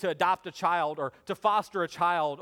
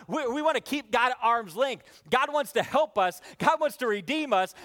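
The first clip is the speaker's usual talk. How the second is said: high, driving sermon delivery; impassioned preaching; 6 semitones higher, 4 dB louder than usual. Real, not acted. Preacher to congregation about keeping God distant, impassioned. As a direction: preach it hard, pitched well up.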